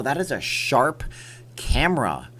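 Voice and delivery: normal talking voice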